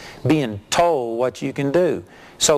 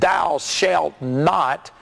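very slow, stretched-out commandment; solemn prohibition; 2 semitones higher, much slower than usual. Acted. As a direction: forbid it one heavy word at a time. Someone pronouncing a prohibition.

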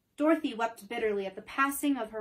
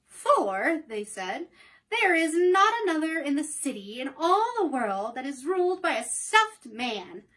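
louder, proud townspeople; proud townsfolk; 4 semitones higher, 6 dB louder than usual. Acted. Townspeople boasting of their ruler, louder and proud. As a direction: proclaim it proudly, slowing at the end.

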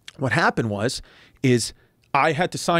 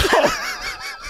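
high squeal of laughter; real laugh. Real, not acted. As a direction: burst out laughing. Laughing at the memory.

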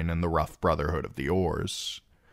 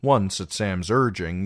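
ordinary delivery

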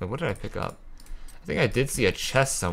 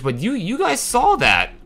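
disbelieving outburst; 13 semitones higher, 4 dB louder than usual. Real, not acted. Streamer asking viewers what they just saw, astonished. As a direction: blurt it out in disbelief. Reacting to absurd enemy pathing.

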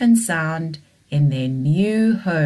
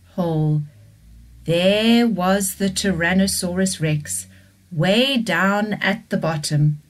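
ordinary delivery, nothing marked

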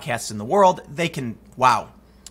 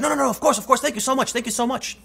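gushing driver; 9 semitones higher, faster than usual. Retold or imitated. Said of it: "And he was like".

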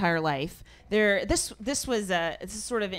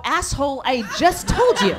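imagined snap; 6 semitones higher, 7 dB louder than usual. Retold or imitated. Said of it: "And I almost go".

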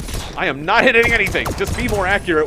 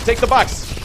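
higher-pitched attacking call; combat taunt; 6 semitones higher, 4 dB louder than usual. Pretend. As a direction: call it out at an enemy. First taunt while attacking.

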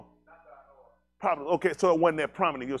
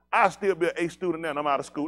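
normal talking voice

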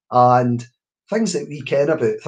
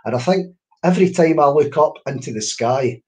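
ordinary talk

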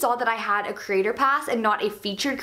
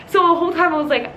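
normal talking voice

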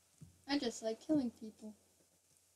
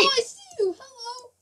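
high teasing voice; 11 semitones higher, 8 dB louder than usual. Pretend. Teasing greeting in game.

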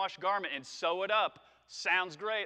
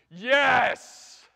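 a loud, high joyful yell; excited wedding cheer; 7 semitones higher, 15 dB louder than usual. Retold or imitated. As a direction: shout it like you're cheering the couple at a wedding. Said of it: "you're just like".

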